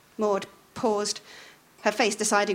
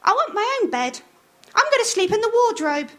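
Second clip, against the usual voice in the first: put-on much higher childlike whine; Sally; 13 semitones higher, 4 dB louder than usual. A narrator's reading. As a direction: sulk like a small child. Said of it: "she said".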